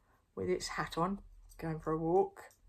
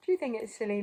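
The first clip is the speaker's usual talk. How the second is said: ordinary delivery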